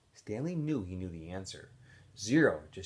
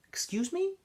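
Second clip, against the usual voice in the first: high, rising incredulous question. Acted. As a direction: demand he repeat that. Warden, highest rising.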